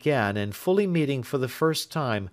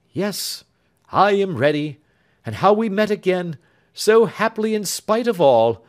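lightly voiced gentle sister; 8 semitones higher, 7 dB louder than usual. Elder sister answers her betrothed gladly.

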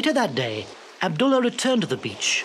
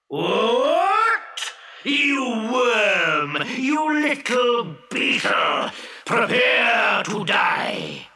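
booming genie, higher and slow, shouting; furious genie; 5 semitones higher, much slower than usual. Acted. Angry genie shouting threats at the little fisherman. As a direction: roar down at the tiny fisherman.

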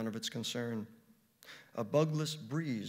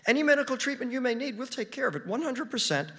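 assuring; 2 semitones higher, 5 dB louder than usual. Acted. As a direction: promise eagerly to cover everything. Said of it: "she assured me".

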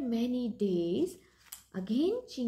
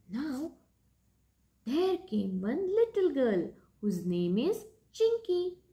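usual speaking voice

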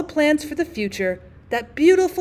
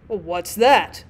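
low, mildly angry demand; a father's stern question, pitched down; 4 semitones lower, much slower than usual. A narrator's reading. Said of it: "ask in an angry voice".

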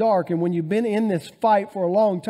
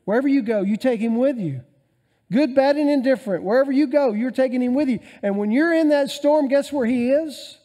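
louder, higher preaching crescendo; 6 semitones higher, 4 dB louder than usual. Acted. Preacher assuring the church God is with them, rising fervour. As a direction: build it up to the congregation.

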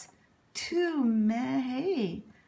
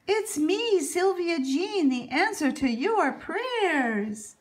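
proud announcement; 7 semitones higher, 3 dB louder than usual. Acted. Girl proudly announces herself.

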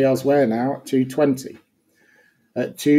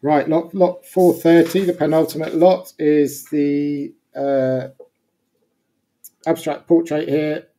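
brisk auction-room bid calling; fine art auctioneer; 4 dB louder than usual. Acted. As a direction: call the bids like an auctioneer. Introducing next lot.

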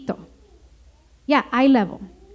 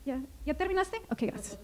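much softer, half-mumbled brush-off; bored kid brushing parent off; 9 dB quieter, much faster than usual. Retold or imitated. Child barely listening to a lecturing parent, mumbled and much softer. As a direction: tune the parent out with a quiet, absent okay. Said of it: "They're like".

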